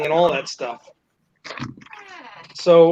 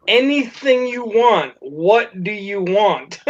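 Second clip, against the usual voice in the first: slow, spaced-out words; drawn out, emphatic repeat; insistent offer; slower than usual. Retold or imitated. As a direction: spell it out for someone who didn't get it. Boss repeats offer after "Excuse me?.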